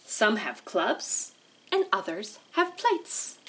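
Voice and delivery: slow, lilting picture-book read with long pauses; reading to children. Acted. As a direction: read it like storytime for little kids. Teacher reads picture book aloud.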